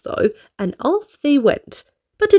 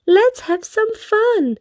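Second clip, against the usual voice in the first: Miss Naughty suggesting; 9 semitones higher, slower than usual. A narrator's reading. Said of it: "suggested Little Miss Naughty".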